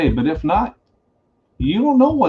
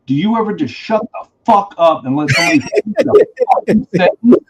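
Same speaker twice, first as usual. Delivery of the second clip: snapping outburst, higher and louder; retold snapping; 6 semitones higher, 4 dB louder than usual. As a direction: snap at the coworker who keeps interrupting. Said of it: "I snapped".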